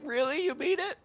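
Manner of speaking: high, breathless "really, you mean it?"; touched disbelief. Retold or imitated. To the friend who asked him to be best man, mock-touched high disbelief. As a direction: squeak it out like you can't believe the honour. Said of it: "I was like".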